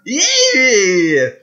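high, loud, drawn-out "yee" yell; war cry. Acted. Gamer as alien warrior taunting the player, shrill war cry. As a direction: shriek it like a charging horseman. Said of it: "a war cry from".

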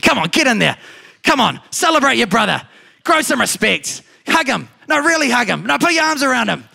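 mock exasperated big-brother telling-off; exasperated scolding. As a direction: shove him along with mounting impatience, pitch climbing. Imagined scolding of the older son.

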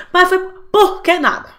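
put-on a child's high protest; whiny child. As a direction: play the cornered kid protesting. Child trying to argue back.

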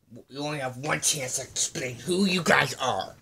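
play-acted threat. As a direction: give captives a loud final warning. Acted threat to captives before execution.

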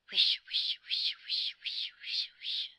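loud, voiceless rustling "wish" repeated; loud tree whisper. A narrator's reading. Trees whisper loudly to the children, a rushing voiceless rustle. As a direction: whisper forcefully like leaves in wind. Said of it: "whispered the trees loudly".

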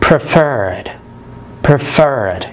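preferred with FERRED stressed; preferred said as pre-FERRED; stress on last syllable. Shown as a demonstration. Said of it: "ضاعف حرف ال R".

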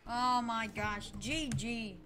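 normal talking voice

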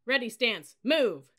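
loud military order, punched out; drill command. A narrator's reading. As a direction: call the command to a formation.

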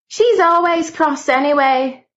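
high, slow sulky grumble; grumbled. A narrator's reading. A young maid sulks about the strict housekeeper, slow, high grumble. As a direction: drag it out like a sulking girl. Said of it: "grumbled the first voice".

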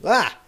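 short loud retold yelp; mock scream. Gamer retelling his own panic to the pigs, loud mock yelp. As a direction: yelp like something jumped you. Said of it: "that was screaming".

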